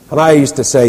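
ordinary delivery

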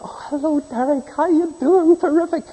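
an acquaintance's overly bright greeting; cheery greeting. Retold or imitated. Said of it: "he turned".

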